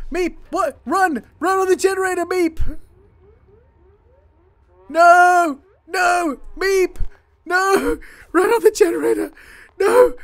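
high, loud near-screaming plea; panicked shout. Real, not acted. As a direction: scream at the little guy to save himself. Screaming at fleeing colonist.

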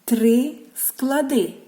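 ordinary delivery

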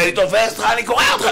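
high-pitched imitated tirade; mock threatening. Rabbi going after Liberman as retold, hammed-up threat. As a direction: threaten him in a high, worked-up voice.

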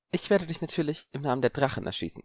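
put-on lightly smug boy, a touch arrogant; mildly haughty schoolboy; a bit smug. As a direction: say it a little superior. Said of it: "klang ein wenig überheblich".

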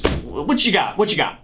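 ordinary talk

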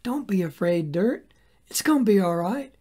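preacher voicing Joel comforting the dirt, tender; tender reassurance. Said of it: "He bends down to the ground and says".

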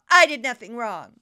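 sudden loud insistence; emphatic protest. Real, not acted. Defendant protesting the case to reporters, emphatic. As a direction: punch it out loudly in self-defense.

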